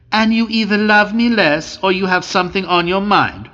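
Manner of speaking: usual speaking voice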